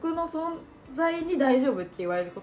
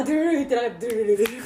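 drumroll noise; 6 dB louder, much faster than usual. Pretend.

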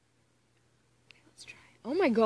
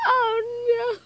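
high, loud whining cry; hammed-up despairing moan; mock wail; 13 semitones higher, 7 dB louder than usual. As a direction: wail in exaggerated dread. Dreads reading the smut aloud.

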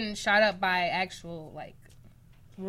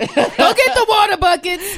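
higher, louder, slower laughing call; laughing loud order; 9 semitones higher, 10 dB louder than usual. Real, not acted. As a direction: bark the order through laughter. Joking order.

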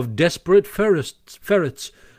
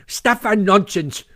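Toad's voice, higher and huffing with anger; very angry; 5 semitones higher, 4 dB louder than usual. A narrator's reading. Toad snaps back at the ferret sentry, angry. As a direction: scoff it away, furious. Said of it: "said Toad very angrily".

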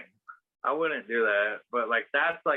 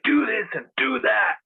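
high, louder mocking bossy voice; bossy mock orders; 11 semitones higher, 4 dB louder than usual. Boyfriend ordering her around at camp, mocked as bossy. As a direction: mimic his bossing around. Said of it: "bossy towards me".

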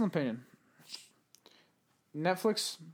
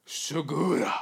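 SEGURA barked out in a put-on Japanese voice; hammed-up Japanese-sounding delivery of "Segura"; mock Japanese accent; slower than usual. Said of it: "anything at all in that voice, it sounds fucking Japanese".

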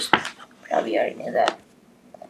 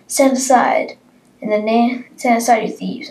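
commanding shout; 5 semitones higher, 5 dB louder than usual. Acted. Guards ordering outlaws away.

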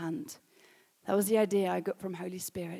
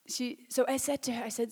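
normal talking voice

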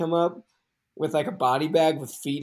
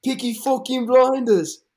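slow, high, louder mock Birmingham accent; Peaky Blinders accent; 10 semitones higher, 7 dB louder than usual. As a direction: drag it out like a hammed-up Brummie gangster. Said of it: "give me your best Peaky Blinders".

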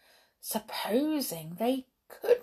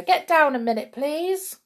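raised, higher-pitched request; calling out; 8 semitones higher, 5 dB louder than usual. A narrator's reading. Soldiers calling the driver down, raised call. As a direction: call up to the cab. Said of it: "they called to the driver".

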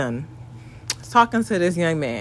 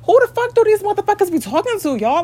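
very high-pitched incredulous demand; indignant inner self; 12 semitones higher than usual. Acted. Subconscious self snatching her up, high and incredulous. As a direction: let the inner voice burst out, pitched way up. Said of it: "literally be snatching me the fuck up like".

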